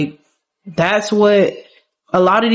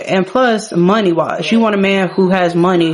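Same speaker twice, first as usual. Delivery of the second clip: usual speaking voice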